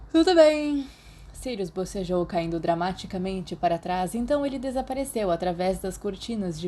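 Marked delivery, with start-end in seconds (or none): 0.1-0.9 high, loud theatrical tudo bem; hammed-up reluctant okay; dramatic sigh. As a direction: overplay giving in. Sirius yawns, dramatic.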